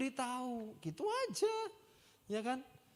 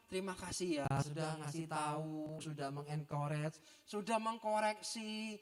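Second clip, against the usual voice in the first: softer and slow, humble thanks; soft grateful thanks; 6 semitones lower, 7 dB quieter than usual. Shown as a demonstration. Pastor models how to thank a corrector, soft and humble. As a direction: say it gently, thankfully.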